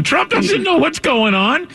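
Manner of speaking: high, drawn-out incredulous rebuttal; slow it right down and pitch it way up in disbelief; emphatic disbelief. Real, not acted. Host rebutting those who say Trump knows, emphatic disbelief.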